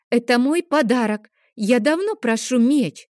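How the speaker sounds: a stubborn son demanding his sword back; demanding boy. Acted. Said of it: "потребовал Лео". (требовательный детский голос)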